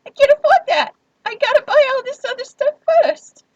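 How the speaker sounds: very high and loud fake panic; mock distraught wail. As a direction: wail it like you're falling apart. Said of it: "all the emotional trauma in my voice".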